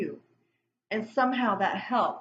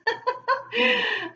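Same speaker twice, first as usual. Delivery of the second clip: high-pitched burst of laughter; real laugh; 13 semitones higher, 4 dB louder than usual. Real, not acted. Reader amused by her own acted reaction, real laugh. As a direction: laugh out loud at your own complaint.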